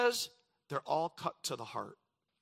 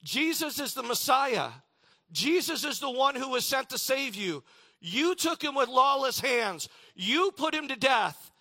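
much higher and louder preaching proclamation; bold declaration; 8 semitones higher, 6 dB louder than usual. Acted. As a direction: proclaim it boldly to the crowd. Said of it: "He declares".

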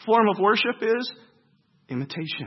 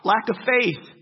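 normal talking voice

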